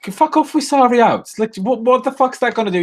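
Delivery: high, disbelieving rant; real fed-up fan; exasperated outburst. Real, not acted. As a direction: fling it away in disgust. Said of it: "It pisses me off".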